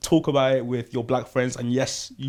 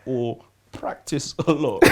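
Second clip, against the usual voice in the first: laughing; 6 semitones higher, 8 dB louder than usual. Real, not acted. Laughing advice to stay away.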